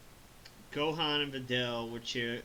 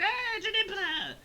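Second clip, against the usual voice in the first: high, slow mock creature call; monster cry; 3 dB louder, slower than usual. Monster following Pikkon to hell, high mock creature cry. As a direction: wail the name like a cartoon monster.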